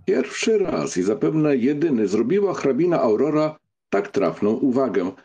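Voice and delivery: ordinary delivery, nothing marked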